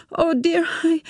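high, unsteady voice of a woman about to sit; dizzy and breathless, searching for words; faint, shaken woman. A narrator's reading. She tells Tim she must sit down, shaken. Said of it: "she staggered to the sea wall".

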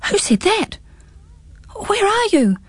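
put-on high, startled boy calling out; jumpy and bewildered, looking around; startled gasp. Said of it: "gasped Pins".

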